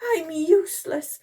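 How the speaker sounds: high, slow self-pitying whine; defeated. A narrator's reading. Soldier giving up before the Admiral, defeated. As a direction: draw it out in defeat. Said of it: "bowing his head in defeat".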